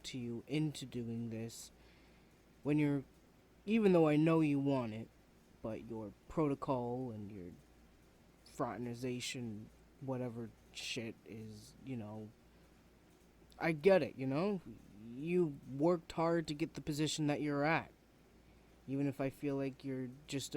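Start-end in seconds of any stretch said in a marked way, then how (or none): 2.6-5.1 raised voice. Acted. Student confronting professor, emotional. 13.6-17.9 frustrated outburst. Acted. Student venting at professor.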